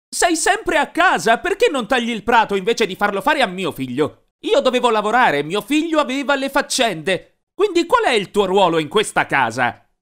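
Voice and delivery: mom berates him at full volume; angry yell. A narrator's reading. Mom yells at Glenn for making her son mow, furious. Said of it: "Poi gli urlò".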